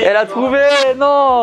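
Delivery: high-pitched cry of disbelief; real outburst at being found out; dismayed disbelief. Real, not acted. Player exclaims at being found out.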